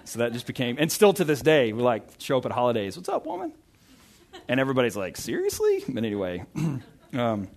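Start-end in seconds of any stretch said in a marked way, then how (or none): 2.9-3.5 high-pitched, softer mock greeting; imitated family holiday hello; playful greeting. 5.2-5.8 high-pitched incredulous question; mock-appalled onlooker; imitated disbelief. Retold reaction of shocked onlookers.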